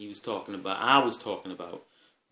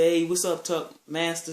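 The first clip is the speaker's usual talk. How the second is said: loud, pitched-up acted hello; hearty greeting; 7 semitones higher, 7 dB louder than usual. Friend greets Tuck Master in party chat, hearty. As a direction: call out to a pal.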